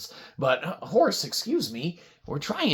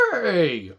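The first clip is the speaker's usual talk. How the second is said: horse neigh; 3 semitones lower than usual. Acted. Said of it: "Here's one".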